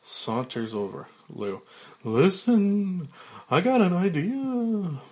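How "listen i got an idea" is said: loud, high, stretched character voice; Lou Wexler. Acted. Lou saunters over with an idea, loud drawling high voice. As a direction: push the voice up and draw out the endings.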